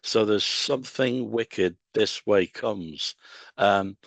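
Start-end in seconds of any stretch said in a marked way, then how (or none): 0.4-3.2 "something wicked this way comes" read slowly, with heavy stress on "some", "wick", "way" and "comes"; four strong beats. Shown as a demonstration. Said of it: "there's an unusual stress of four".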